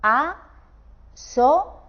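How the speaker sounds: asociáis drawn out very slowly, "ciáis" held as one beat; syllable by syllable. Shown as a demonstration. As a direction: stretch asociáis out slowly to show the triphthong. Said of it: "diríamos". (asociáis muy lento, ciáis en un solo golpe de voz)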